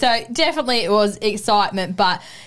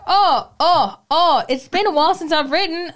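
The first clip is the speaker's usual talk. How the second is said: flustered oh oh; 8 semitones higher than usual. Retold or imitated. Retelling her flustered reaction.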